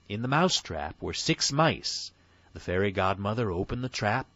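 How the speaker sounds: ordinary delivery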